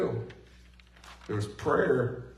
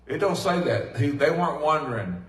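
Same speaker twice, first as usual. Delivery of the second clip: ordinary talk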